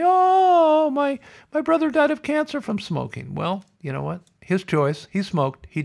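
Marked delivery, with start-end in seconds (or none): 0.0-3.4 mock wailing. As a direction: wail it like a hammed-up sob story. Mimics complainers pleading sympathy.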